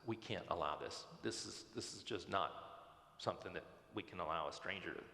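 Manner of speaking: retold refusal, low and measured; lightly acted supervisor turning someone down, pitched low; flat-out refusal. Supervisor refuses a stranger camping out, low flat-out refusal. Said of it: "flat out says".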